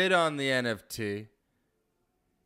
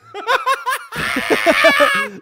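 high, loud cackling laugh; cracking up hard; loud burst of laughter; 11 dB louder than usual. Real, not acted.

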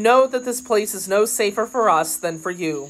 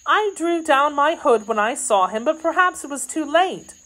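high, worried maid's voice; anxious maid; 9 semitones higher than usual. Acted. Kitty fearing the man recognized her, worried. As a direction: confess it in a high, uneasy voice.

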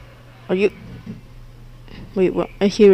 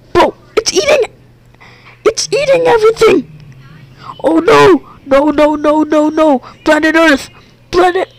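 frantic high yelling; 10 semitones higher than usual. Real, not acted. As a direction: freak out as it's ruined again. Reset planet eaten again, frantic.

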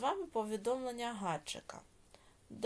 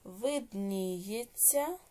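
slow, drawn-out syllables with rising ends; dictation; much slower than usual. Shown as a demonstration. Teacher dictating exercise eight to pupils, slow measured dictation. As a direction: dictate each word slowly, leaving long writing pauses.

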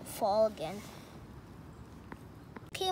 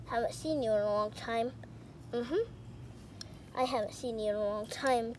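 normal talking voice

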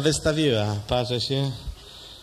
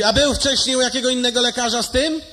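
high, loud doctor's question; 9 semitones higher, 6 dB louder than usual. Acted. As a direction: question him as a brusque doctor. Doctor asks. (lekarz pyta szorstko, wysoko i głośno)

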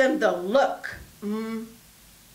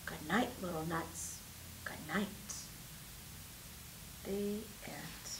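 soft goodnight; 8 semitones lower, 19 dB quieter than usual. A narrator's reading. Bedtime story closing.

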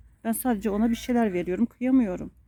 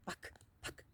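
whispered; 25 dB quieter than usual. Real, not acted. Hushed to dog.

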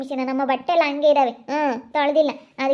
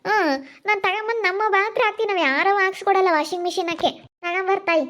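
high-pitched quarrelling retort; insistent, higher-voiced comeback; defiant insistence; 6 semitones higher than usual. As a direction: argue back in a raised pitch. Acted quarrel over washing machine.

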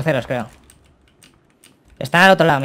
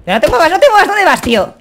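shouting; 13 semitones higher, 6 dB louder than usual. Real, not acted. Teammate in danger mid-fight.

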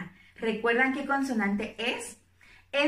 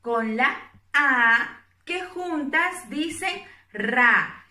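slow syllable demo; slower than usual. Shown as a demonstration. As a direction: spell out the syllable slowly for small children. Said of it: "La primera que podamos encontrar". (sílaba pronunciada despacio y clara)

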